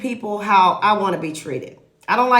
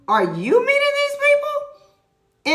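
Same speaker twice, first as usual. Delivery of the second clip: shocked disbelief; slower than usual. Retold or imitated. Realtor shocked seller meets strangers.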